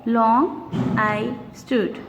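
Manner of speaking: slow, each word set apart; slow syllable reading. Shown as a demonstration. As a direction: read slowly so each beat is heard. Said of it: "Let's see the third one".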